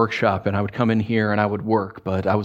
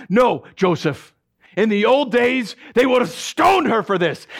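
high, loud stern refusal; angry father; 11 semitones higher, 3 dB louder than usual. Acted. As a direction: slam down his son's plea. Father keeps raging.

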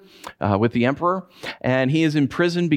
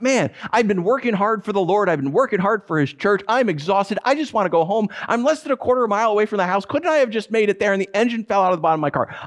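high-pitched exasperated rant; worked-up mock tirade at bad luck; angry venting; 7 semitones higher, faster than usual. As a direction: retell a fed-up complaint, pitched up and tumbling out. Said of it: "I'm angry at this point".

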